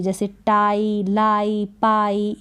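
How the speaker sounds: "टाई, लाई, पाई" slow, long आई drawn out; slow demo words. Shown as a demonstration. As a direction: read the words slowly, stretching आई. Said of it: "long आई ऐसे". (लंबा आई खींचकर धीरे धीरे)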